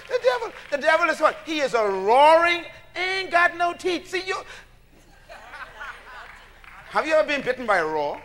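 loud, rising call to the congregation; full-force preaching. Acted. Preacher calling out to his congregation, full-force preaching.

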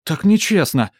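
high, loud indignant protest; outraged spoiled girl; cartoon princess. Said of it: "возмущённый голос принцессы". (возмущённая принцесса)

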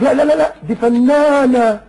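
high, stretched-out mock reverence; mock admiration. Voicing someone defending an actress, mock-awed and high. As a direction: gush it slowly with a laugh.

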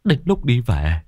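high-pitched wailing account, rising at the end; choked sobbing. Acted. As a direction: plead through tears in a high, choked voice. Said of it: "khóc nghẹn ngào". (khóc nghẹn ngào, giọng cao)

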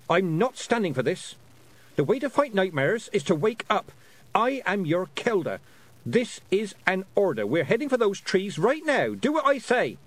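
put-on high, forceful girl giving orders; commanding. Girl ordering pixies to the trees, commanding. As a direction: build to an insistent high command.

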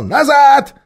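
high, loud ape orders; high loud ape shout. Acted. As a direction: bark them back. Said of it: "обезьян закричал".

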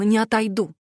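usual speaking voice

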